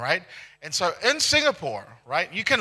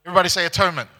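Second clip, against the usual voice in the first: louder, fired-up call to the congregation; passionate call; 8 dB louder, much faster than usual. Shown as a demonstration. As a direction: rouse the church to shout it back. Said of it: "That's better".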